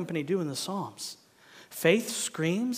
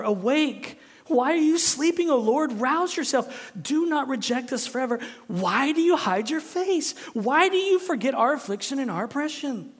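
loud, high, urgent protest; impassioned psalm of complaint; crying out to God; 8 semitones higher, 5 dB louder than usual. Acted. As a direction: rouse a sleeping God with your voice. Preacher cries out psalm to God.

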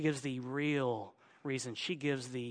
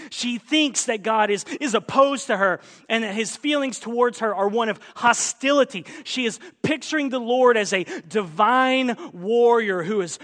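raised, loud sermon delivery; forceful preaching; 7 semitones higher, 9 dB louder than usual. Real, not acted. Preacher declaring where Naomi errs, at full force. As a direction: hammer the point home to the congregation.

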